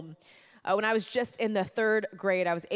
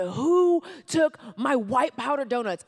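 retold outburst, loud, high and stretched out; full-on scream; 6 semitones higher, 8 dB louder, slower than usual. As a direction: demand it in a slow, outraged yell. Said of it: "full on scream".

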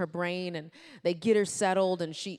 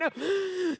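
high, hammed-up crying gasp; sobbing gasp; 12 semitones higher than usual. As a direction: suck in air like a wailing child. Mock sobbing breakdown.

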